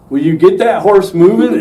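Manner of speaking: the director shouting at him to get the stuck horse moving, half-mock yell; retold director's yell. Director yelling at stuck rider.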